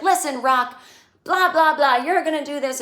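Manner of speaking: mock-rally him with a burst of energy; pep talk. Charlotte rushing backstage to rally Rock, excited urging. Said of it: "she runs backstage and she's like".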